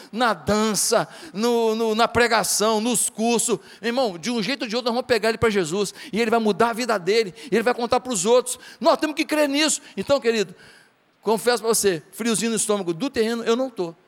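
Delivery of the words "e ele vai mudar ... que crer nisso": usual speaking voice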